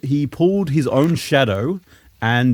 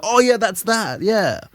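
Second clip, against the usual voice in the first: mock casual; 8 semitones higher, slower than usual. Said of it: "to be like".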